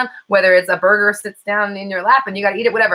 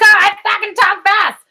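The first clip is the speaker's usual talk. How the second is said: slow, much higher and louder, each word punched; defiant emphasis; 14 semitones higher, 7 dB louder, slower than usual. Real, not acted. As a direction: own it defiantly, drawn out. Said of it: "This is how I talk".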